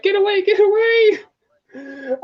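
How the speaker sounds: high, stretched-out imitation cry; frantic quote. Fan quoting a cartoon to a friend, high mock cry. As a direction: squeal it out high and drawn-out, mock-panicked.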